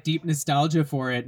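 normal talking voice

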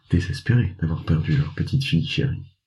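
usual speaking voice

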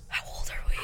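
whispered aside. Real, not acted. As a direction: whisper it under your breath.

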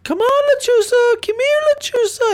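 high falsetto calling out; mock beckoning call. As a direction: imitate a dad teasingly summoning a spirit. Acted dad taunting the creature.

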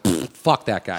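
low, slow scoffing grumble; dismissive scoff. Retold or imitated. Grumbling about the hot guy, low and scornful. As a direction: brush him off with a pfft. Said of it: "I was like".

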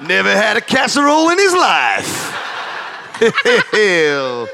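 put-on Deep South lawyer accent. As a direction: lay on a Deep South twang for the jury. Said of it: "in the style of a Deep South defense lawyer".

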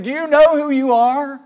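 urgent preacher's plea. Retold or imitated. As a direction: imitate an old preacher shaking someone at the door. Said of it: "Shaking somebody by the shoulder saying".

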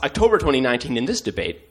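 ordinary talk

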